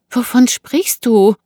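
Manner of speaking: high, loud, slow question; bewildered woman. Acted. Woman asks the knifeman what he means, bewildered. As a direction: ask in alarmed confusion.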